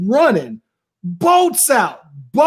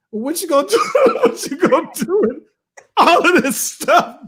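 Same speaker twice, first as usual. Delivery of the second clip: laughing quote; 7 semitones higher than usual. Retold or imitated. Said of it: "so Janae says".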